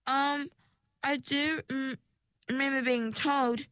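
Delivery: high, halting, feeling for the memory; hesitant recalling. A narrator's reading.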